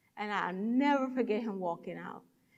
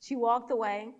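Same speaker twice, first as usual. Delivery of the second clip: ordinary delivery, nothing marked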